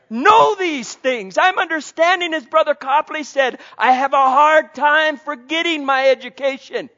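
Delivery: loud, high preaching climb; preaching at full force. Acted. Preacher rousing the congregation, loud and high. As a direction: lift the congregation with fervent preaching.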